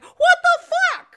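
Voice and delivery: very high, loud, slow squawk of alarm; the stomach suddenly panicking; cartoon stomach yelping in shock. Stomach voiced in retelling.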